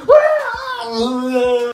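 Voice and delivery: loud mock scream of agony; hammed-up shrieking wail; high-pitched scream. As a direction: imitate a kid screaming. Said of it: "he's, like, screaming".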